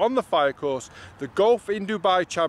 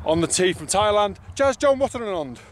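announcer call; 3 semitones higher than usual. Shown as a demonstration. Practising starter announcement.

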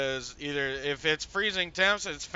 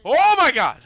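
startled scream; 9 semitones higher, 11 dB louder than usual. Real, not acted. Door slammed in face.

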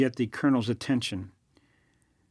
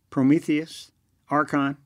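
growled; 3 semitones higher than usual. Acted. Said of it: "growled Chernov".

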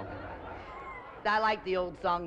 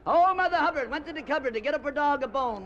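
nursery rhyme reading; 7 semitones higher than usual. Acted. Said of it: "for the children's hour".

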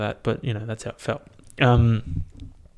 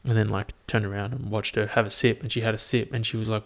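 normal talking voice

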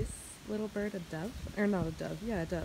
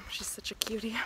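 ordinary talk

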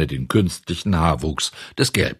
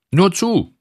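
high, loud call toward the door; a cheery, loud call to come in; 12 semitones higher, 5 dB louder than usual. Acted. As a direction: call out to the knocking visitor. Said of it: "rief der Schreiner".

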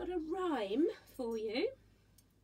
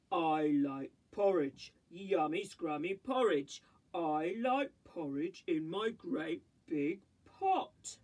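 big slow papa bear savouring his porridge; low booming daddy bear; 8 semitones lower than usual. Acted. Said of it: "A Daddy Bear voice".

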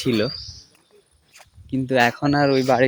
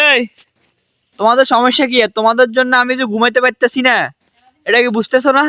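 angry scolding; 10 semitones higher, 3 dB louder than usual. Real, not acted. Woken by shouting kids, scolds them loudly. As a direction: tell them off for the racket.

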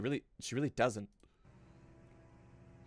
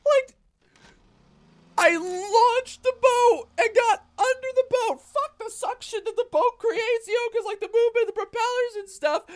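excited rant; 6 dB louder than usual. Real, not acted. Excited rant after boat mishap.

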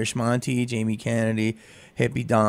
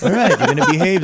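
mock bouncer warning; 8 semitones higher, 8 dB louder, faster than usual. Bouncers voiced in story.

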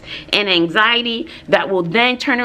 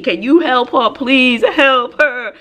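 mock pleading; 3 semitones higher, slower than usual. Pleading with husband Bobby.

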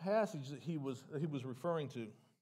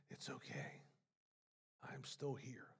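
soft, breathy near-whisper, much softer; small still voice; 4 semitones lower, 14 dB quieter than usual. Retold or imitated. Preacher voicing the small comforting voice, hushed and much softer. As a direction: barely voice it, like a small comforting voice inside. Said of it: "little small voice saying".

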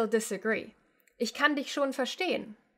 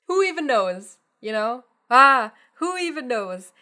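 exasperated shrug; 8 dB louder than usual. Shown as a demonstration. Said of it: "verbally throwing up your hands".

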